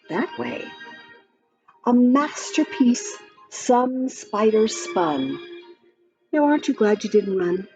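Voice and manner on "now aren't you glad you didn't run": ordinary delivery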